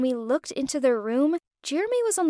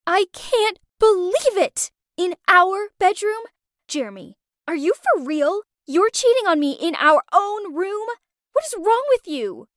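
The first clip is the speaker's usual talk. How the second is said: furious; 7 semitones higher than usual. Acted. Naomi catching Jeremy cheating in their room, furious and high. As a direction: confront a cheating boyfriend in full fury. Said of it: "She was super angry".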